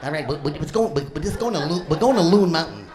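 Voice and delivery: stammering, stumbling over the start; halting mock stutter with repeated words; Porky Pig. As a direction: mimic a flustered stutter, restarting the phrase. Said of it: "he's stumbling. He's like Porky Pig".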